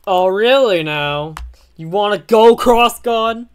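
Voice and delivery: loud, rising confrontation; defiant challenge. Acted. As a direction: dare the villain to fight. Hero challenges villain.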